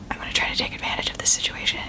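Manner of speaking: fast, conspiratorial whisper; scheming whisper. Retold or imitated. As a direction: plot it under your breath. Voicing a scheming impostor.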